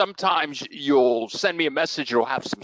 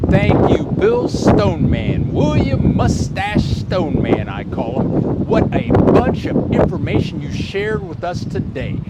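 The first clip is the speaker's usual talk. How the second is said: loud, upbeat show-host outro; show host sign-off; 3 semitones higher, 7 dB louder than usual. Acted. Host thanking his guest Bill on air, in a loud announcer voice. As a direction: boom it out like closing the show.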